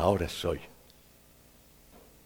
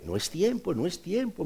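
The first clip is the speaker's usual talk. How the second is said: calm potter; 7 semitones higher, 6 dB quieter than usual. Acted. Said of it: "me decía el alfarero".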